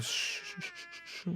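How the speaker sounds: long, soft, repeated shushing; soothing shush. Acted. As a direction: hush the baby with gentle shh sounds. Father shushes the crying child. (desis pelan menenangkan bayi)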